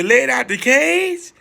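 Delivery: put-on a light foreign accent on "Lady out the cage?", slow and questioning; slight accent. Owner on the phone repeating it with a slight accent. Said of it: "With a little accent".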